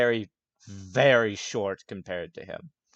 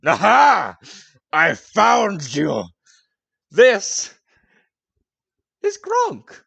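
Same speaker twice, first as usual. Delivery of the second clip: booming, high triumphant bellow; Goliath bursting in; 9 semitones higher, 12 dB louder, much slower than usual. Acted. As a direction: announce yourself to the whole tavern. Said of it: "bursts through the door and goes".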